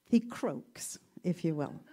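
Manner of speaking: ordinary talk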